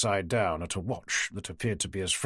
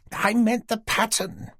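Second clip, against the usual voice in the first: put-on higher, slower, mildly reproving old lady; scolding; 10 semitones higher, 3 dB louder, slower than usual. Dorothy correcting her friend in a scolding tone. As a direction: tell her off gently. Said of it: "in a scolding tone".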